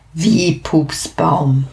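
stunned at the word; baffled. A narrator's reading. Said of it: "Da bin ich erstmal sprachlos". (verblüfft, langsam nachgefragt)

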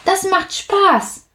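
cheerful. Acted. Platsch promises fun.

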